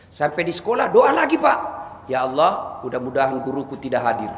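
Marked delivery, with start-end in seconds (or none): 2.1-4.3 softer, lower cheeky pupil prayer; hint of a sly schoolkid; mock student prayer. Student praying the teacher stays away, softly sly.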